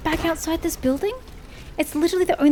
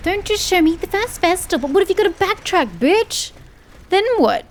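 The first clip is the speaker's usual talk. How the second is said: raised, higher, fast-building rant at the guide; exasperated rant; 6 semitones higher than usual. Real, not acted. Gamer ranting at a guide that skips the route, exasperated and raised. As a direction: vent at the useless guide, voice climbing.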